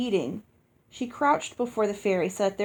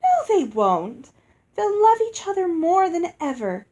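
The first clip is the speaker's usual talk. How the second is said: higher and louder, bright sing-song insistence; insistent musical fairy; 8 semitones higher, 6 dB louder than usual. A narrator's reading. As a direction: brush their worry aside sweetly. Lucinda insists, after tinkling laugh.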